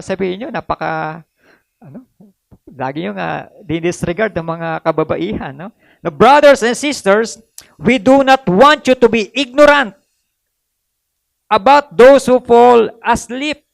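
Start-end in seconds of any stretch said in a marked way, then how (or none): 6.0-13.6 measured pulpit reading of scripture; solemn scripture reading. Acted. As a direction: read the verse like it's holy. Reads scripture aloud.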